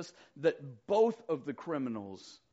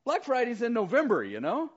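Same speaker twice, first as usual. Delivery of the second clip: surprised objection; 7 semitones higher, 7 dB louder than usual. Retold or imitated. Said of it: "they were like".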